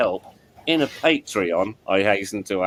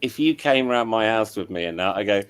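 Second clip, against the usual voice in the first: ordinary delivery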